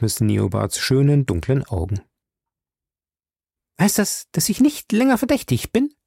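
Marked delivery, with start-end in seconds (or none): 3.8-5.9 put-on much higher, hopeful young woman; Betty asking the detective, smiling; smiling question. A narrator's reading. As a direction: ask it with a relieved smile. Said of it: "lächelte sie meinen Chef an".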